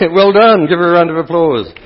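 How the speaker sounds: loud, high cheer of well done; excited praise. Real, not acted. Priest praising the volunteer before applause, excited. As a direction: celebrate her like a winner.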